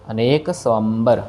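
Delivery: स्वंबर with a quick sv, not su-ambar; स्वंबर said short. Shown as a demonstration. Teacher modelling स्वंबर with b not v. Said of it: "सुअंबर नहीं कहना".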